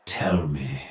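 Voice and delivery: low, softened voice; quiet, low menace; hushed probing. Acted. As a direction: drop low and soft to draw them in. Villain probes his opponent.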